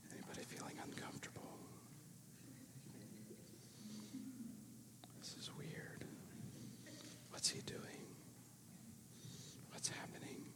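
playful whispered asides during a silence. Pretend. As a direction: whisper it like you're breaking an awkward pause.

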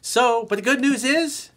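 loud, high, drawn-out sales pivot; upbeat announcer pivot. Real, not acted. Coach switching to his book promo, loud and upbeat. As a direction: brighten up for the pitch.

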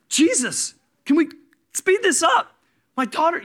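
loud, high-pitched frantic plea; acted desperate, exasperated shout; impatient urging. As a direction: hurry him up, voice way up high. Acting Jairus urging Jesus on.

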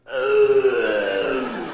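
low, loud disgusted animal grunt; a heavy loud sea lion groan. Acted. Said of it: "he's going".

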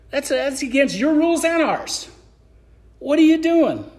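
who do you think you are, played as an offended, sarcastic woman; indignant sarcasm. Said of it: "She wasn't happy about this".

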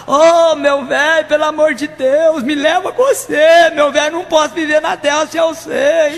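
high, slow, loud hammed-up graveside wail; mock wailing widow. Old widow wailing into her husband's grave.

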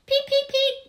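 very high, loud squeaky peeps. A narrator's reading. Girl peeping out of control: loud high chirps. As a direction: chirp it out as loud as you dare. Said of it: "peeping louder and louder".

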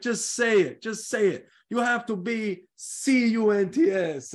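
imitating the coach while wishing he'd swear; urging in Italian accent. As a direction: egg him on to say it, Italian-style. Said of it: "And I was just like".

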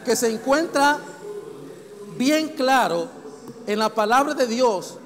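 loud, emphatic sermon delivery; forceful preaching. Real, not acted. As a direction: hammer each item of the list, loud and separate. Preaches a list of sins.

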